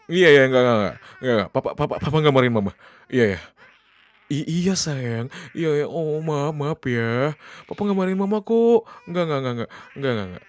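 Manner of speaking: loud, urgent, repeated reassurance; flustered backtracking. Acted. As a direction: hurriedly take it back to calm the child. Backtracking as the child cries harder. (buru-buru menenangkan dengan suara panik)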